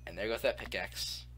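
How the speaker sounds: ordinary talk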